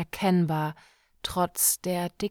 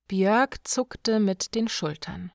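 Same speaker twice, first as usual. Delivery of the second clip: ordinary delivery, nothing marked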